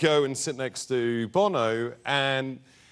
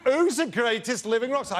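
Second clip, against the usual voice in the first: higher-pitched mock exasperation; exasperated insistence; 6 semitones higher than usual. Imitating [name] demanding a better answer, exasperated. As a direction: reject it and press the question.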